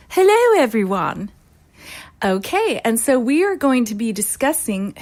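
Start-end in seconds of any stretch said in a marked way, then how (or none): 0.1-1.3 campy drag queen. Acted. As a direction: sweep up into a grand lady greeting. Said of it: "And Lady Penelope Mauve".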